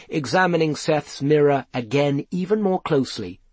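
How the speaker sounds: ordinary delivery, nothing marked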